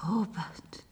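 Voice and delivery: whispering. Acted. Drugged Jane whispering to Robert.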